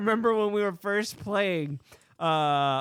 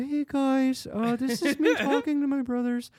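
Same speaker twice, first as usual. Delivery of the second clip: higher, breezy bro greeting; casual brother voice; 8 semitones higher than usual. Shown as a demonstration. Showing how he talks to his brothers, breezy and high. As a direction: greet them like your brothers, pitched up. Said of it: "That's how I talk to my".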